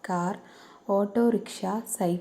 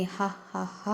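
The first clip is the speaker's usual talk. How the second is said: soft, breathy mock ha-ha of a crow; mocking crow laugh; 7 dB quieter, much slower than usual. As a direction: laugh at them like a cheeky crow, softly. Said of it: "കാക്ക പറയുന്നുണ്ട്".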